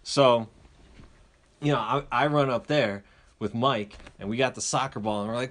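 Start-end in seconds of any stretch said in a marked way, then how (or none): none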